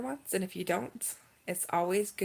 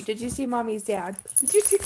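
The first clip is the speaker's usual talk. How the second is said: high and louder, cooing at the dog; 3 semitones higher, 6 dB louder than usual. Real, not acted. Mom cooing to her dog Olive, baby talk. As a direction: coo at your puppy.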